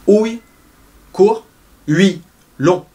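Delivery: "Ouille" short and high, "ui" long; "Ouille" court, "ui" long; short versus long. Shown as a demonstration. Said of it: "court ... long".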